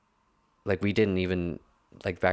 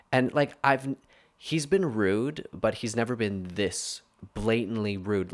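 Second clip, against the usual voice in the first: normal talking voice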